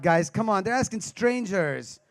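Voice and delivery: ordinary delivery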